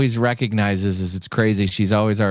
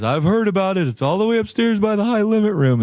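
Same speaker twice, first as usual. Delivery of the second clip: high, hurried retold directions; excited imitation; 10 semitones higher, faster than usual. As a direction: imitate a frantic friend gabbling in a high voice. Friend's frantic quote continues.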